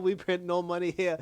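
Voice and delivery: lightly mocked broken-English accent; a hint of feigned broken English; put-on broken English. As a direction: mimic simplified immigrant English, amused. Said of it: "she done went from".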